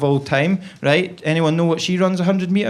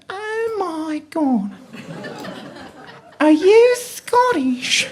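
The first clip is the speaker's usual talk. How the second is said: very high, slow mock Australian voice; Australian woman; 14 semitones higher, much slower than usual. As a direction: gush as an imitated Australian woman, stretched out. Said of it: "And she went".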